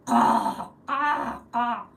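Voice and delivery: loud raven caw; a raven croaking its call. Retold or imitated. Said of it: "Or the raven is like".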